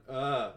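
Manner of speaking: imitated choked-up vocalizing; wordless overwhelmed cry. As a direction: re-enact a speechless outburst. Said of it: "like, vocalized 'cause I couldn't speak".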